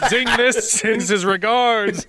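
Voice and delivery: mock tough guy. Cohost pretending to threaten the festival, slow laughing mob menace. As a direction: lay it on like a movie hitman. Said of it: "I'm gonna be like".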